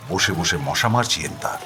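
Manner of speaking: plain reading voice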